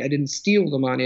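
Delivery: "steal" punched, rest of the line light; stress on steal. Shown as a demonstration. Literature example for the host, stressing "steal". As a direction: lean on the word "steal". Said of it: "but the stress is different".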